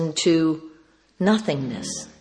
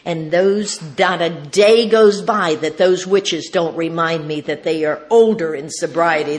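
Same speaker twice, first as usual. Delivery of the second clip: usual speaking voice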